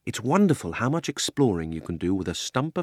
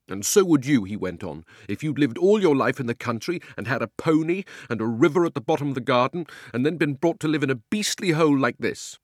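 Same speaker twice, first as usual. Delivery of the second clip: louder, raised, aggrieved outburst; wound up; 6 semitones higher, 6 dB louder than usual. Acted. Homesick boy ranting to Polly about London, louder and higher. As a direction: let it all pour out, louder and higher. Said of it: "too wound up to take any notice".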